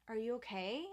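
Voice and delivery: hushed caring check-in; gentle concern. Retold or imitated. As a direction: ask softly, checking on her. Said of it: "I was like".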